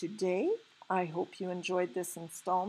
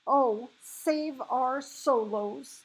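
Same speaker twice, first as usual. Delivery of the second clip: drawn-out anguished cry, raised pitch; anguished fury; 6 semitones higher, 4 dB louder, much slower than usual. A narrator's reading. BFG lamenting over his catch, anguished. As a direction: wail it slowly in dismay. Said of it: "filled with fury and anguish".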